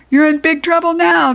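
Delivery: high, loud gleeful taunt; gloating. A narrator's reading. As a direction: crow over the boys. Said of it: "chuckled Miss Anthrop".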